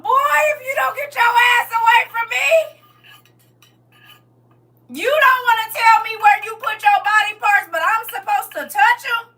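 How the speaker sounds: high, loud, cracking yell; outraged shout. Real, not acted. Warning a man to back away from her, shouting. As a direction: shove him off with your voice. Rejecting a partner's kiss.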